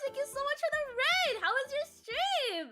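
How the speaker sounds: very high, loud squealing surprise; excited shout. Real, not acted. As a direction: shriek a thrilled welcome to raiders. Surprised by an incoming raid.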